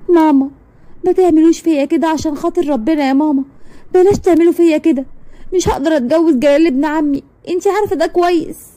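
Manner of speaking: high, tearful plea to mother; a daughter begging her mother, high and tearful; tearful pleading. Acted. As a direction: beg her mother through tears. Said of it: "بتعيط جامد قوي ومقهورة".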